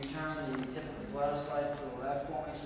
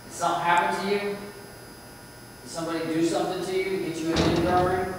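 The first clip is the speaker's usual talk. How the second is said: raised voice, questioning the spirit; calling out loud; 6 semitones higher, 12 dB louder than usual. Real, not acted. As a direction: call out loudly to the room.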